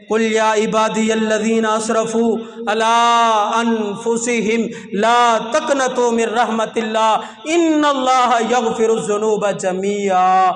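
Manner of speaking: slow chanted Arabic recitation; Quranic recitation. Acted. Preacher quoting the Quran to the gathering, slow recitation. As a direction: intone the verse slowly, like tilawat.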